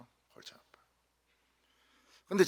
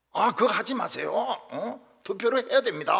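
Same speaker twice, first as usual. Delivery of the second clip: higher and louder, mock prim Seoul-dialect arguing; Seoul speech quarrel; 9 semitones higher, 6 dB louder than usual. Pastor imitating Seoul elders quarrelling in Seoul dialect, higher and louder. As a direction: argue politely in Seoul dialect, but heated. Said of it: "서울 말로 싸우더라고요".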